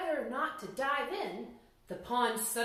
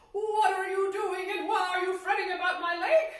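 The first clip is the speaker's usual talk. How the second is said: put-on high, loud old lake spirit, laughing a little; booming old man; 8 semitones higher, 6 dB louder than usual. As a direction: boom it out as the demanding old man. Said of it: "the old man demanded, his voice as booming".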